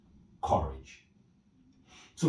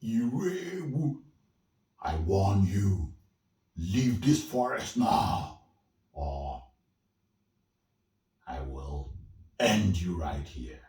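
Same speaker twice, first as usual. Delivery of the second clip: slow, loud threat from the spirit; menacing spirit warning; 5 dB louder than usual. Acted. Forest spirit warning Irebu to leave, slow and loud. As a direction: warn him slowly, each word a threat.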